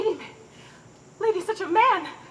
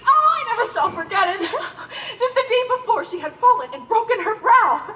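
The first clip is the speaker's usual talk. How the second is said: high, chuckling storytelling; laughing reminiscence; 5 semitones higher, 3 dB louder than usual. Acted. Nurse telling her favourite toddler story, laughing. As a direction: keep breaking into laughter as you recall it.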